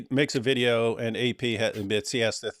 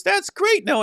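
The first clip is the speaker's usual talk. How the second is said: squeaky, far higher gushing praise; gushing mother; 4 dB louder than usual. Retold or imitated. Mocking the TV mother's praise, in an extreme high gush. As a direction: squeal it like an overjoyed mom. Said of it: "And she goes".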